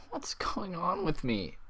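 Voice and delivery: slow, breathless whisper; frightened whisper. A narrator's reading. Twilight alarmed at her own rage, in a slow frightened whisper. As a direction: whisper it, stunned at herself.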